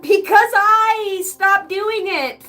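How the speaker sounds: loud, high, drawn-out outburst; exasperated parent. Acted. Parent telling kids why laundry piled up, exasperated. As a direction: let them have it, slow and loud.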